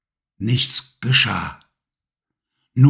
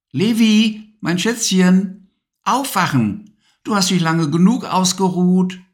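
put-on higher, gentle mum's voice waking a child; tender mother; 8 semitones higher than usual. Mama waking Levi at his shoulder, gentle higher mum voice. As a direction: coax him awake like a loving mum.

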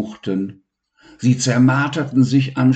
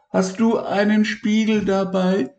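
high, hurt little voice; Elmar's voice; 11 semitones higher than usual. Acted. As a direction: whine about the sore spot.

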